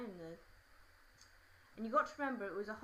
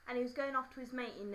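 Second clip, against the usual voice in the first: usual speaking voice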